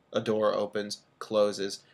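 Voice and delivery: ordinary delivery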